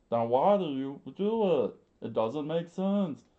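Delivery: put-on high defiant boy, restarted take; loud high boy voice. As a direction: insist like a stubborn boy begging to fight. Boy Kappa argues.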